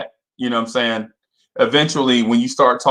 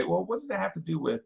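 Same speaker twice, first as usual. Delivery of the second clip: skeptical viewer; 2 semitones higher, 12 dB quieter, faster than usual. Retold or imitated. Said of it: "I know y'all gonna say".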